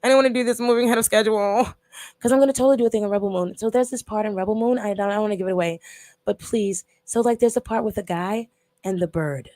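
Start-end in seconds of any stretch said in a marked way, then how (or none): none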